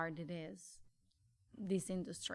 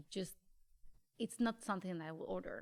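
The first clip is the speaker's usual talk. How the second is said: ordinary delivery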